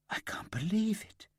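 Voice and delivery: whispered, high disbelief; stunned whisper. A narrator's reading. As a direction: breathe it out in shock. Shocked friend.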